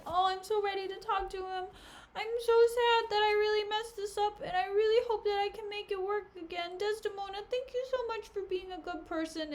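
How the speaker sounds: high-pitched mock pleading, laid on thick; hammed-up earnest gratitude in a put-on high voice; gushing apology. Cassio thanking Desdemona after messing things up, high mock gushing.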